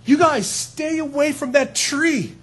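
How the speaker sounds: put-on like a father begging kids to stay safe; gentle warning. God warning Adam and Eve away from the tree, gently. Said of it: "God says".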